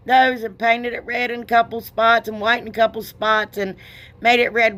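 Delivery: ordinary talk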